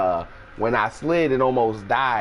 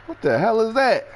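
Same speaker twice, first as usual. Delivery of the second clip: much higher, startled voice; a much higher, startled roommate; 13 semitones higher than usual. Retold or imitated. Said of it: "I hear a motherfucker like".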